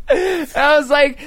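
high slow laughing words. Real, not acted. Retelling the angry customer call, laughing. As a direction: barely get it out laughing.

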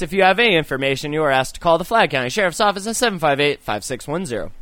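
rushed anchor sign-off; fast news tagline. Acted. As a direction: rattle off the hotline number.

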